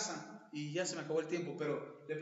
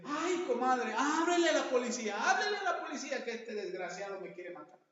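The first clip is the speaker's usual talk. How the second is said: frantic wife; 5 semitones higher, 5 dB louder than usual. Retold or imitated. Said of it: "le dice".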